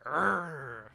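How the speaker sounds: drawn-out "rrrr" growl; rolled mimicked growl. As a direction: imitate a hype man's rolled growl. Imitating the clip's noise.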